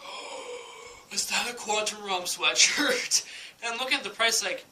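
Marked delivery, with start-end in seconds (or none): 0.0-3.2 breathy gasped "oh", then drawn-out amazement; awed surprise. Retold or imitated. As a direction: re-enact a slow, amazed discovery. Acts out spotting the sweatshirt.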